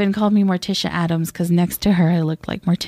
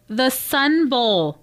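very slow, higher, imitating exasperation; exasperated girlfriend; 4 semitones higher, much slower than usual. Girlfriend answering her clueless boyfriend, slow exasperation. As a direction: spell it out slowly for a clueless boyfriend. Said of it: "And the girlfriend was like".

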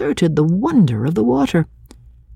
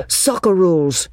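quick playful comeback, slightly higher; retort; 4 semitones higher than usual. A narrator's reading. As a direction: throw it back at a playmate. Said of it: "George retorted".

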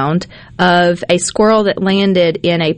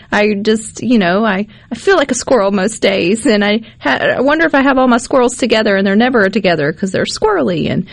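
ordinary delivery

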